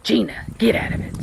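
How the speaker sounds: hushed, breathy urgent scold; whisper yelling. Real, not acted. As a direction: shout at the dog in a whisper. Scolding dog.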